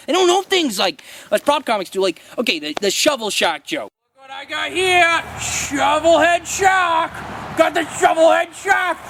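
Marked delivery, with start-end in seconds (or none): 4.1-9.0 showman voice. Acted. Presenting prop on stage.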